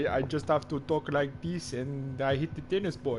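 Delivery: mock Spanish accent, quiet and lightly done; a weak stab at Nadal's Spanish accent; bad Rafa Nadal impression. Co-commentator joking about accents does Nadal, mock Spanish accent. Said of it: "That is the worst Rafael Nadal".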